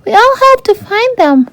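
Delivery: very high, squeaky proud voice; proud little fish. A narrator's reading. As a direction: puff up with pride like a small fish. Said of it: "Flounder said proudly".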